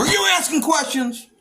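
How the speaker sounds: high, sharp mock cop challenge; imitating a cop who is picking a fight; hostile cop. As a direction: needle him like a hostile cop. Angry cop in a retold stop.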